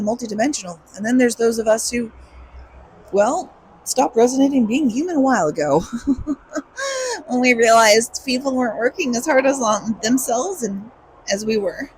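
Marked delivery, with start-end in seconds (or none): 5.9-7.2 laugh. Real, not acted. Laugh at herself.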